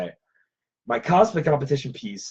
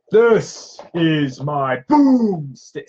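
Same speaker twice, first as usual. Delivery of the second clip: very slow, louder, drawn-out movie hero line; Ash one-liner; 5 semitones higher, 7 dB louder, much slower than usual. Retold or imitated. Cosplayer rehearsing Ash's boom stick line, slow and cocky. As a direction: savor every word like a cocky hero. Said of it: "hard not to speak in one-liners".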